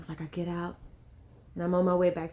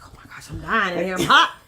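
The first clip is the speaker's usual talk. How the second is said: exasperated outburst building to a loud yelp; overheated complaint; 12 dB louder than usual. Retold or imitated. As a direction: act out desperate, comic whining about heat. Retelling overheating while pushing.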